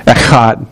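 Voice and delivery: "Echad" with a throat-clearing ch; guttural Hebrew pronunciation. Shown as a demonstration. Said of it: "I didn't clear my throat. That's just the way you say it".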